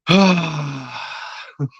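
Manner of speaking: high, breathy exhale; long airy sigh that fades away; exaggerated sigh. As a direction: let out a long audible sigh. Loud breath after the boss speech.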